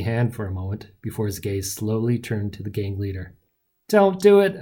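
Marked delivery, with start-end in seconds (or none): 3.9-4.6 high, loud plea; begging. A narrator's reading. Narrator pleads before hero retaliates, begging. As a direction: beg him to stop. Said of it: "I begged".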